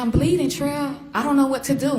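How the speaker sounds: high, loud panicked cry; reliving the panic, voice jumping up. Retold or imitated. Mother retelling to the court, high frightened plea.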